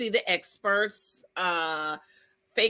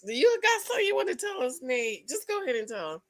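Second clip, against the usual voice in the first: ordinary delivery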